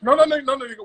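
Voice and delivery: ordinary talk, nothing marked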